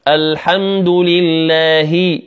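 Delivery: slow, sustained الحمد لله ending in pure i; clear "hi". Shown as a demonstration. Teacher modelling correct recitation, clear "hi" in لله. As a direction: draw out لله and close on "hi". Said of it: "لا يقول هي".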